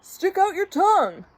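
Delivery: high, animated order; urgent prankster. Acted. Ernie sets up a trick on Bert, high and urgent.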